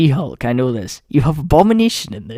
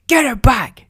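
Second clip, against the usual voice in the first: high, loud imitation of Falcon; sharp mock shout; aggressive shout; 9 semitones higher, 5 dB louder than usual. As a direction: yell it as an angry order. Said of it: "being aggressive towards the Hulk".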